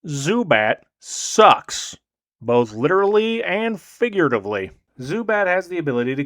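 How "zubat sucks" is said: slow deadpan verdict. Real, not acted. Flat verdict on Zubat.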